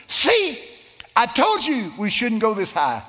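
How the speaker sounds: high, loud whiny retort; mimicking Bob. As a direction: squeal it like a scared friend. Bob's retold complaint, shrill.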